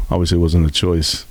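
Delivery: normal talking voice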